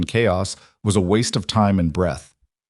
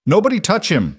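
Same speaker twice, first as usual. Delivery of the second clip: high-pitched urgent yell; narrated shout, high but only a bit louder; 10 semitones higher, 3 dB louder than usual. A narrator's reading. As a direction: yell an order to a crowd, pitch jumping up. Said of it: "Pruitt was yelling".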